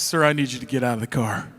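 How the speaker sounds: a cop's low, measured order; stern state trooper. Retold or imitated. State trooper ordering dad out of the car, low and firm. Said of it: "Walks over to my dad's side of the car and says".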